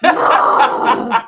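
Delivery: loud, drawn-out no breaking into laughter; protesting cry. Real, not acted. Film fan rejecting friend's excuse, loud laughing protest.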